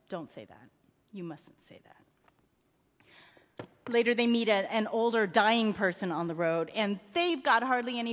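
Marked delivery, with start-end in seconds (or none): 0.1-2.0 very soft, low father's plea; near-murmured comfort to a child; tender hush. A narrator's reading. Father soothes sobbing son.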